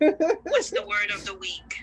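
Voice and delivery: high, slow announcer voice; game-show host voice. Pretend. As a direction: ask it like a quiz-show host.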